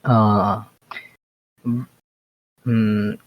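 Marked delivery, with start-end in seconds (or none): none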